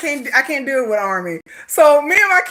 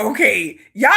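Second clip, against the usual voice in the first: usual speaking voice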